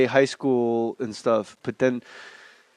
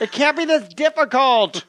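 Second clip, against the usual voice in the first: high, exasperated fan bellow; big hollered complaint; arena-filling heckle; 11 semitones higher than usual. Retold or imitated. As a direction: heckle the players from far up in the stands. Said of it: "fill Madison Square Garden with his voice".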